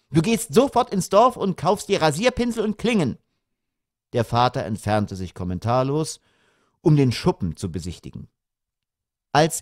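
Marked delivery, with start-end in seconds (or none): 0.1-3.2 high-pitched commanding wife; bossy mother. A narrator's reading. Mother sending father to buy razor blades, bossy. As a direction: order him off in a raised pitch.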